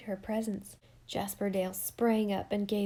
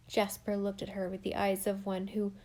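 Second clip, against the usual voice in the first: plain reading voice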